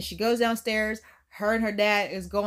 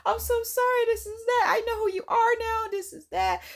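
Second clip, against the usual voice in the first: high-pitched mock crying apology; whiny pretend sorrow, pitched up; tearful apology; 12 semitones higher than usual. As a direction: lightly imitate a weepy plea.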